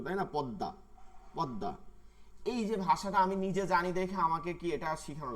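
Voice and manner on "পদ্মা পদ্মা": পদ্মা said as পদ্দা with a doubled d. Shown as a demonstration. Teacher shows how পদ্মা is really said. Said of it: "জফলার মতন উচ্চারণ হবে".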